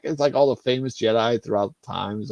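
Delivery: normal talking voice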